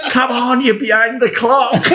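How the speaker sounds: high, loud, laughing taunt; mock-cheer the runners through a laugh; laughing mimic of Ron. Ron taunting the players on the run, laughing high. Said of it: "and he's going".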